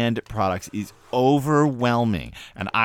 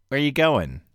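ordinary talk, nothing marked

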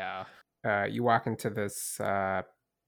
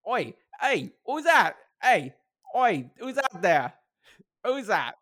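high, rough guard voice in a bad Irish accent; hammed-up Irish sentry, pitched well up; 11 semitones higher, 3 dB louder than usual. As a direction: call out like a suspicious Irish guard. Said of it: "It can be a bad Irish accent".

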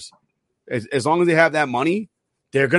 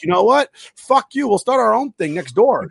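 higher-pitched mock defiant retort; retold defiance; 6 semitones higher than usual. Teams tell the National League they are leaving, mock-defiant. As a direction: act out a brash kiss-off. Voicing teams breaking away in anger.

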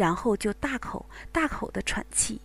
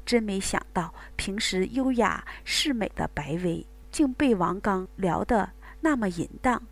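ordinary delivery

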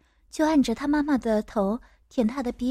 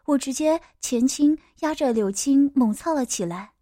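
ordinary delivery

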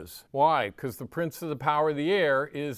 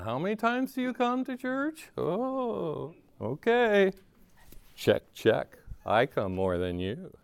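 mock self-righteous churchgoer; 4 semitones higher than usual. Preacher lampooning churchgoers who compare attendance, smug mock voice. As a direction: play the smug rule-keeper lightly. Mocking checklist Christians.

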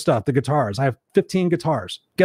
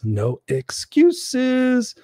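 quoting a follower; 9 semitones higher, much slower than usual. Retold or imitated. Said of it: "Angel says".

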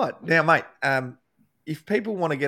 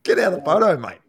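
louder mock brush-off to a star; mock telling off; 6 semitones higher, 6 dB louder, faster than usual. Mate telling Michael Jordan to get out of the photo, mock brush-off. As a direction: shoo him out of the photo, half joking. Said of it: "you imagine telling Michael Jordan".

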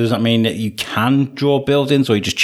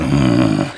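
disgusted grunt; 9 semitones lower than usual. Retold or imitated. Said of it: "walking past a wall and going".

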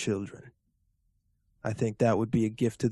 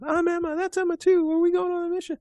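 put-on little girl voice; faster than usual.

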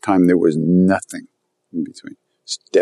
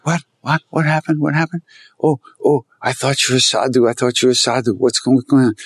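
higher, innocent puzzled what-what; mock surprise, lightly raised pitch; feigned bewilderment; 5 semitones higher than usual. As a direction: play dumb, wide-eyed. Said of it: "Maharaj's going".

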